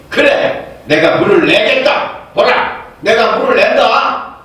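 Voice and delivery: a preacher voicing Moses losing his temper; angry Moses. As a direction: hurl the words back at the crowd. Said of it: "모세가 얼마나 화가 났는지".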